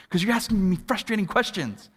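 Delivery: hammed-up husband losing his patience; angry, frustrated. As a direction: snap back, building to a high shout. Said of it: "kinda angry, frustrated thing".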